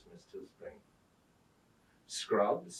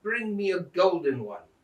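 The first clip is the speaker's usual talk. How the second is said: raised, eager plea; eager cry; 6 semitones higher, 8 dB louder than usual. A narrator's reading. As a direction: ask greedily for gold. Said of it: "cried Scrub".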